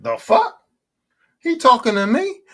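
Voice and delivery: high, loud startled disbelief; a kid startled in the dark by his dad's call; confused surprise. Retold or imitated. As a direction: blurt it out, shocked he means you. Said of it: "be like".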